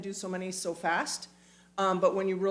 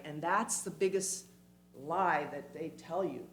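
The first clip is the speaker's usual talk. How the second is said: ordinary delivery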